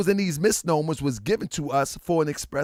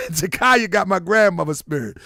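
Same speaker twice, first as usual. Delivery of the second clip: ordinary talk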